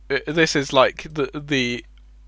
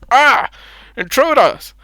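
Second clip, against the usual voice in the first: high, loud cry of alarm as the guard is grabbed; startled shout; 11 semitones higher, 8 dB louder than usual. Retold or imitated. Guard grabbed by the players shouts in high alarm. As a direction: yell it out like a guard caught off guard. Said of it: "He's like".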